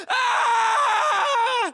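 sharp yell of pain; wounded soldier's yelp; pained cry. Acted. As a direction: cry out as if hit. Soldier hit in battle.

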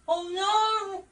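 high, loud cry of dismay. Real, not acted. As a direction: shout it in alarm. Reacting to mishap.

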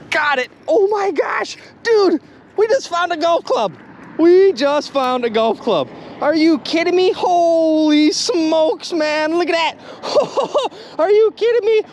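very high, excited exclaiming; real jubilant astonishment at a lucky find; thrilled disbelief. Real, not acted. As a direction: cry out high in amazed delight, stretching the big words. Pulls up a golf club.

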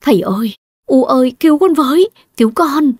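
high, pleading cry for help; desperate crying plea. Acted. Tied to the altar, she cries out to her parents in despair. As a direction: beg your parents to save you, voice rising. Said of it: "Diệu An gào khóc trong tuyệt vọng".

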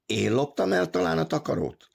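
high, indignant narrated outburst; furious cry. A narrator's reading. Pion losing his temper at Big Joe, crying out. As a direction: throw the question out angrily. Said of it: "kiáltotta".

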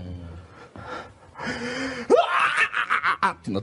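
high, stretched-out imitated wail; mock wailing sob. Woman left crying alone in the snowy park, mock wail. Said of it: "ボロボロ泣き出して".

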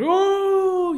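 very high sustained mock ooh; imitated crowd gasp. Crowd reaction in story.

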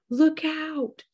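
warning cry, pitched high; a high urgent warning call, held back; mild mock yell. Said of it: "who yells to his friend".

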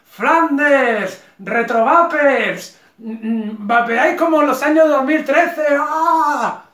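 high, loud mocking taunts; burla a gritos. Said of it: "el que se ríe de ellos". (burla exagerada, aguda y a voces)

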